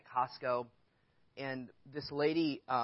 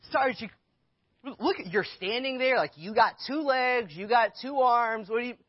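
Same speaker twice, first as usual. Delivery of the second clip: indignant retort; 9 semitones higher, 8 dB louder than usual. Retold or imitated. Costco worker scolding customer's son for not helping, indignant and loud. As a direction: tell him off for standing there.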